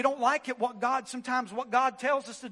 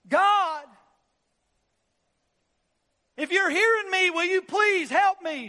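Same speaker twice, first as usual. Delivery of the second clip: high, loud call for help; man clinging to a root shouting upward; desperate cry for help; 6 semitones higher, 6 dB louder than usual. Acted. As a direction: yell for help while dangling over a cliff. Man hanging from cliff root.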